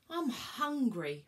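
drawn-out hungry moan, slow.

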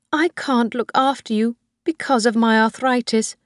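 put-on a high old woman pleading with her son; old Mrs Harvey. Old woman voiced by reader.

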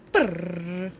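low rolled lips drum roll. Shown as a demonstration. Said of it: "Drum roll".

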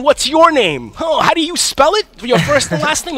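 high, slow mock-official questioning; lawyer grilling. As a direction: spell out each question like a lawyer. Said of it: "my lawyer started asking".

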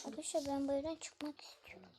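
hushed whisper to viewers. Real, not acted. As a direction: whisper it secretly.

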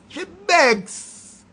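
high, sneering "She begs"; mock "begs" pitched high. Shown as a demonstration. Scene: mocking people who beg for wedding money, high sneer.

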